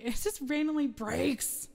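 normal talking voice

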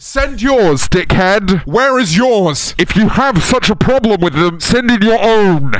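loud, indignant rant; angry shouting. Real, not acted. Streamer telling complaining viewers off, angry shouting. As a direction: yell it back at the critics.

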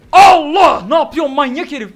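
loud, high cry of alarm; high startled yell; startled shout. Real, not acted. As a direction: yell as the monster leaps. Enemy jumps at him suddenly.

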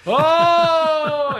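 very high, loud, sustained "ohhh"; imitated crowd uproar. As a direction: mimic a shocked crowd erupting. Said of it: "the whole crowd went".

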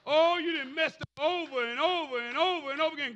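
high, loud, exclamatory pulpit shout; loud, rolling sermon cry; full-force preaching. Acted. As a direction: whoop it out and work the congregation.

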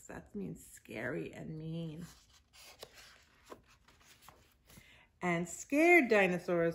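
patient picture-book narration; reading to children. A narrator's reading. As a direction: read it aloud to little kids, drawing out the words.

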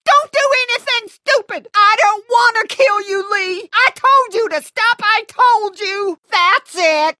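loud, high, frantic threats; panicked shouting. Acted. Ordering Lee to stay back in a standoff, frantic shouting. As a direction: shout desperately to hold them off.

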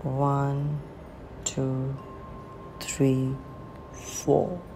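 soft, spaced-out meditation counting; guided breathing count. Acted. Meditation guide leading listeners through inhale, hold and exhale, softly counted. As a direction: count the breaths gently, leaving room to breathe.

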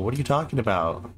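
ordinary talk

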